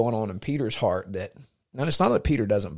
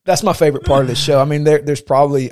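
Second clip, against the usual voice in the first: louder, higher and quick; excited; 5 semitones higher, 10 dB louder than usual. Real, not acted. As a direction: gush with delight. Gushing about favorite show part.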